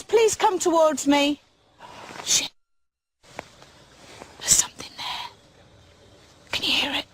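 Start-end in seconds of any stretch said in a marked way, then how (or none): 1.8-7.1 tense whisper in the dark; scared hushed whisper. Real, not acted. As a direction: breathe it so nothing hears you. Alone in dark woods, ghost hunting.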